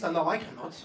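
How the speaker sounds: ordinary delivery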